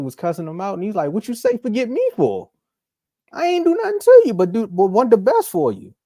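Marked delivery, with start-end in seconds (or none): 1.1-5.9 high, loud hurt protest; mock outrage. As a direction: plead as if wronged, pitch climbing. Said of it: "like that [name] meme".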